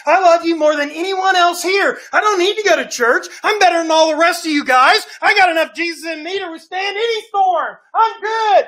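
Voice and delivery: high, loud bragging; boastful shout. Acted. Peter loudly assuring the Lord he is the best. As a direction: shout your own greatness.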